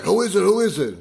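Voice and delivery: put-on high, loud call of a jumpy old neighbor; wary neighbor at the door. Neighbor calls through his door at the knock, high and loud. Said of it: "he's like".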